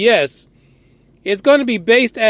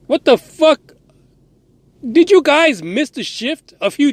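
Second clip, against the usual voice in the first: incredulous outburst; 5 semitones higher than usual. Real, not acted. Disbelieving rant at NASA.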